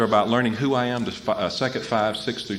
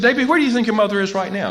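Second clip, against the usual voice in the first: higher, louder, faster counselor voice; counselor's question; 12 semitones higher, 6 dB louder, faster than usual. Retold or imitated. Counselor challenging David, higher faster voice. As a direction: press the question high and quick.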